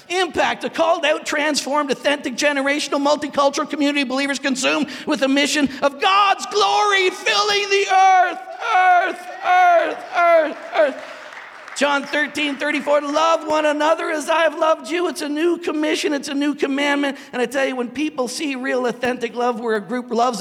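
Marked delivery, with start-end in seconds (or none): 0.1-11.0 high, loud, building preacher's cry; full-force preaching. Acted. Pastor shouting the church vision to the congregation, full-force preaching. 11.8-17.2 high, loud, building preacher's cry; full-force preaching. Acted. Pastor shouting the church vision to the congregation, full-force preaching.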